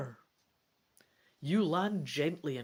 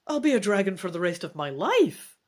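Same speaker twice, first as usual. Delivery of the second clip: louder, faster shocked question; 7 dB louder, faster than usual. Acted. Dragon son reacts to his mother's bad news, shocked. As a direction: blurt it out in disbelief. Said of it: "you ask, shocked".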